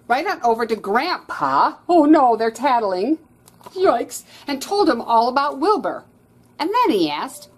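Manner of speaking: loud, eager storytelling; excited. A narrator's reading. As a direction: build the drama loudly. Excited reading of tattling.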